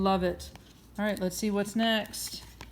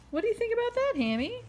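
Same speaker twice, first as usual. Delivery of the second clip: much higher, squeaky question; 13 semitones higher, faster than usual. Acted. Mother asking the puppet, squeaky. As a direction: pitch right up into a squeak.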